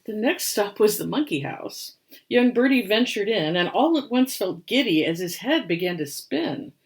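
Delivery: put-on lively read-aloud for children; rhyming story narration. A narrator's reading. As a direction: tell the rhyme with storybook bounce. Reads picture book aloud.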